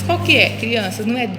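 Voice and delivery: ordinary delivery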